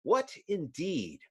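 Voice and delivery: ordinary delivery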